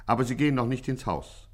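ordinary delivery

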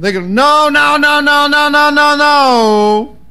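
high, loud mock panic, rising to frantic no's; hammed-up fearful Jesus, babbling in alarm; panicked. Preacher plays a frightened Jesus hearing of the Pharisees, in loud mock panic.

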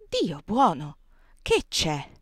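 jolted awake, calling out; aunt Clo woken by a knock, startled; startled exclamation. Acted. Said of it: "esclamò la zia Clo".

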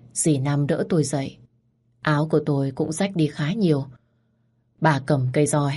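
ordinary delivery

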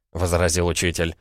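ordinary delivery

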